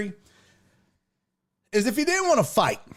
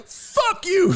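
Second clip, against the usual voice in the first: high, sharp mock retort through a laugh; mock defiant; 10 semitones higher than usual. Voices Andres snapping back at the pleading man, laughing. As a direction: spit it back half-laughing. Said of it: "Andres is like".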